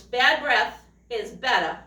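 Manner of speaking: usual speaking voice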